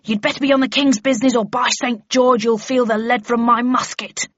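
sentry shouting down at intruders; yelled threat. Acted. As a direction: bellow a threat from the deck. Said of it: "yelled one".

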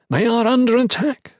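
high, loud, teeth clenched; through clenched teeth. Acted. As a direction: squeeze it out through gritted teeth. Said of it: "through clenched teeth".

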